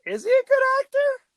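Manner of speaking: high, doubtful mock viewer voice; a puzzled viewer judging a bad actor. Viewer mocking a stiff nineties actor, high and doubtful. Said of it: "where you're just kinda like".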